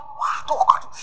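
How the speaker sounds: breathless whispered gasp of awe; awed whisper. Retold or imitated. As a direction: whisper it like a stunned spectator. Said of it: "Sampai semua orang yang nonton itu".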